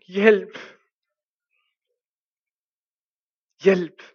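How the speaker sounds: loud, pleading call for help; desperate cry for help. Retold or imitated. Climber lost in a snowstorm calls out, desperate shout. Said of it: "han råber i desperation".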